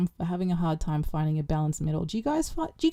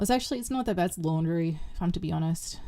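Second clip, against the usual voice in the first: ordinary talk, nothing marked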